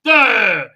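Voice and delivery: loud, high mock impact shout; loud vocal thud; imitated impact noise. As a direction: blurt out a crashing sound effect. Imitates throw at the wall.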